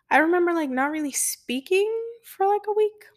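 fighting back tears while remembering; choked up. Real, not acted. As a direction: voice pitched high and tight with tears. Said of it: "I'm getting a little choked up".

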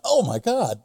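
high-pitched startled exclamation; reliving a shocked reaction; retold shock. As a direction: blurt it out, taken aback. Retold shock at bathroom encounter.

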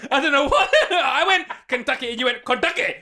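words spilling out through real laughter; can't stop laughing at his own blunder. Real, not acted. Host to listener Jason, laughing at their bad accents.

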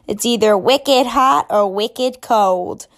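put-on slow, loud Boston accent. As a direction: lean into a thick Boston accent. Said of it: "can't really do a Boston accent".

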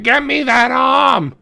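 high, slow "Get me that arm!"; an owner demanding the pitcher; mock demanding owner. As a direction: bark it like a greedy boss. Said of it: "that's the one that's like".

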